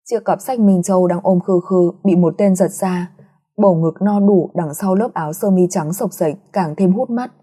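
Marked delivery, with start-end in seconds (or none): none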